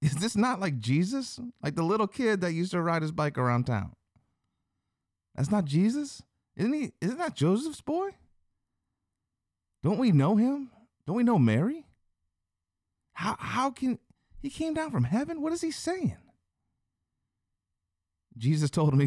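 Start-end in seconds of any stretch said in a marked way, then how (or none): 0.0-4.0 high-pitched disbelieving townsperson; mock-bewildered voice pitched way up; incredulous crowd. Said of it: "He's like". 5.3-16.2 very high, squeaky-pitched disbelief; each question shooting up in bewilderment; incredulous crowd. Retold or imitated.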